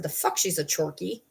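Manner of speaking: dry retold comeback, pitch dropped; retold skeptical retort. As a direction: challenge the claim, low and unimpressed. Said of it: "I said".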